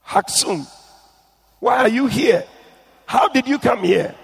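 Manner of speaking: high, surprised question; startled to see him there. A narrator's reading. Grandmother surprised to see grandson in hell, high. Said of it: "and surprised, she asked".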